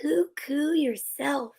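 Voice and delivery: whispered cooing dove; soft sweet dove. Acted. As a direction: coo it gently and hushed. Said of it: "came a soft, sweet voice".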